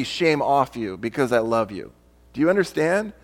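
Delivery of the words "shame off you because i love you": loving. Retold or imitated. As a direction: lift the shame off her gently. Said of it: "the other one would be".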